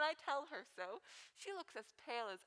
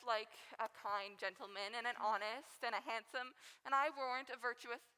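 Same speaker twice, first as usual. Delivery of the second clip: ordinary talk, nothing marked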